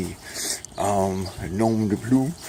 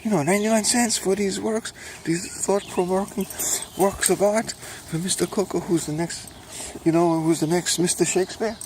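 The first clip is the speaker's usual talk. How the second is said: much higher, gushing fan; excited reader voice; 10 semitones higher than usual. Pretend. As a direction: rave like a thrilled customer. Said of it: "So people will say".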